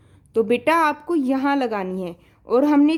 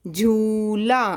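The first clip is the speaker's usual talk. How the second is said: slow repeat; 3 semitones lower, much slower than usual. Shown as a demonstration. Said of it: "ऐसे ही आपको सुंदर writing में लिखना है".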